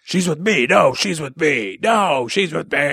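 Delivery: whiny back-and-forth bickering; mock squabble. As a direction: squabble like rivals, stretching the last word. Acted quarrel over Poison Ivy.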